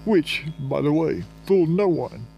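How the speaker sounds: crisp, formal, talking-down collector voice; condescending bill collector. Retold or imitated. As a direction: lecture a debtor with official condescension. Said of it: "was still all condescending to me".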